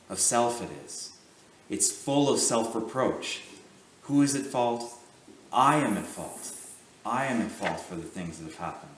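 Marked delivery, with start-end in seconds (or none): none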